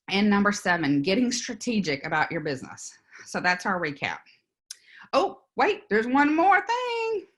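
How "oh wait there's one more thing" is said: high, playful bonus announcement, last words stretched out; mock sudden afterthought. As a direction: pretend you just remembered, pitched way up and drawn out. Staged afterthought before a bonus tip.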